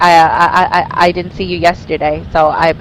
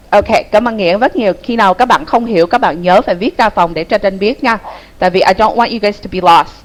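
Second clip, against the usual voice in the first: normal talking voice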